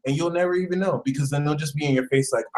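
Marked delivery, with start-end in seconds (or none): none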